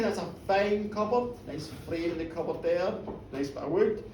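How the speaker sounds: low, soft thinking aloud; hushed, idle appraisal; muttered musing. Acted. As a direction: murmur it casually to yourself. Hamish feigns casual interest in cupboard.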